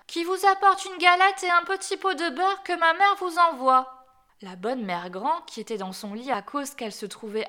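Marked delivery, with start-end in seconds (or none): 0.1-4.0 high, loud put-on little-girl voice; wolf's falsely girlish pitch, pushed loud; wolf disguising his voice. A narrator's reading. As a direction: imitate the child to fool granny. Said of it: "dit le loup en contrefaisant sa voix".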